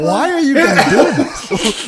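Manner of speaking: high, slow, laughing plea; laughing disbelief. Retold or imitated. Pleading with the hostesses, high slow laughing disbelief. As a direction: stretch it out, half laughing in disbelief.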